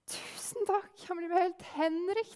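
a hint of an excited little boy; lightly voiced happy boy. A narrator's reading. Delighted boy gets shoes. (gutten takker for skoene, lett spilt og henrykt)

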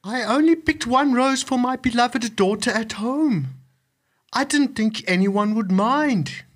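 put-on terrified old man pleading; anxious, acted plea. A narrator's reading. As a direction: plead for mercy, voice projected. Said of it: "pleaded the old man".

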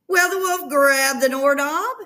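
plain reading voice

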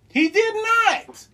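high, drawn-out objection; emphatic denial. Real, not acted. Disputing the co-host's Jim Ross claim, emphatic denial. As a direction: stretch it out in high-pitched disbelief.

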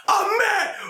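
high mock yell; angry shout. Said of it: "repeats Boondocks quote".

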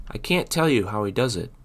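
ordinary delivery, nothing marked

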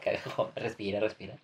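calm your angry friend down gently; soothing. Real, not acted. Calma al amigo enojado. (calmando en voz baja)

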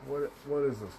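usual speaking voice

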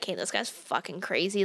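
low, quiet muttered aside. Retold or imitated. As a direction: mutter it under your breath. Muttered judgment in story.

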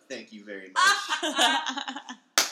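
drawn-out laughing thanks; slow, laughing through the words. Real, not acted.

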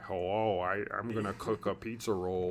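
a hint of a goofy character impression; funny cartoon character voice. Fan imitating a voice actor for a friend, lightly put-on funny voice. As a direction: lightly imitate a comical cartoon voice, kept soft. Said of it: "that guy just has the funniest voice".

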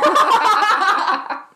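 high loud cackle; real high laugh; loud laughter. Real, not acted. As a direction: burst out laughing. Laughing at joke.

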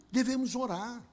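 ordinary talk

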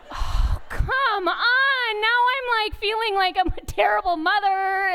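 high, slow, half laughing, half crying; real laugh-cry of a guilty mom; laughing through tears. Real, not acted. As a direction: caught between a laugh and a cry. Mom hurt by son's remark.

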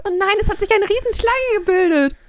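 high, loud ride-game voice; hammed-up ride drama. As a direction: ham up the fairground ride. Playing fairground ride scene.

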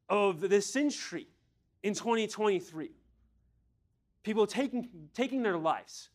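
high, loud run of urgent questions; impassioned preaching. Real, not acted. Preacher asking congregation why God values heart over mind, impassioned preaching.

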